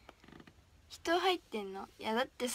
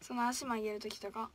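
ordinary talk

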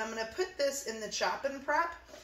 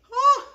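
high, loud "oh" at burning eyes; loud pained exclamation; 15 dB louder than usual. Real, not acted. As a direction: cry out from the onion sting. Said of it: "I can feel my eyes burning".

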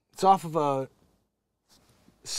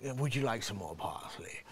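softer, lower put-on voice of a prissy chef; stupid chef voice; 5 semitones lower, 9 dB quieter than usual. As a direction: mimic a dopey, overly polite chef. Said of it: "he has that stupid type of voice".

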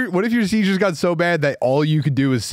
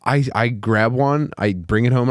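usual speaking voice

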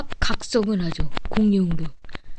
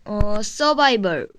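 ordinary delivery

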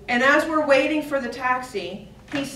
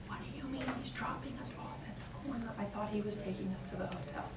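fast breathy whisper; a boy tugging mom's sleeve, whispering; anxious whisper; 17 dB quieter, faster than usual. Retold or imitated. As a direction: hiss the worry urgently. Son whispering worry, sleeve tug.

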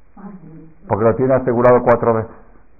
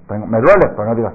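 ordinary delivery

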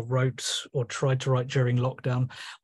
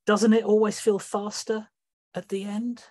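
higher, fading sea god closing; Neptune; 7 semitones higher than usual. Acted. Neptune closing the inquisition, higher fading voice. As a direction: let the last question trail off. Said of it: "in the voice of Neptune".

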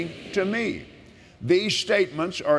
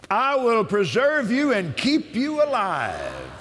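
preaching at full force; 6 semitones higher than usual. Acted. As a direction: shout the promise like from the pulpit.

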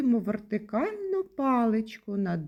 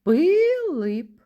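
first И of "Пилип" held long, high and loud; "Пилип" with a long, loud first И to single out the sound; second sound stretched; 8 semitones higher, 6 dB louder than usual. Shown as a demonstration. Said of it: "Наступний звук у слові".

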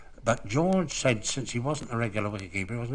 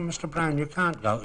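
mock-pleading nurse; 7 semitones higher than usual. Nurses stopping him leaving hospital.

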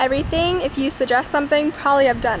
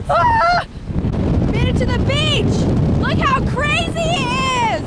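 shouting over wind; 12 semitones higher, slower than usual. Real, not acted.

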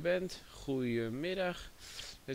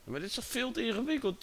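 exasperated; 10 semitones higher, faster than usual. Real, not acted. Frustrated at confusing settings.

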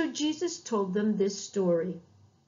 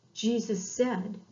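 ordinary delivery, nothing marked

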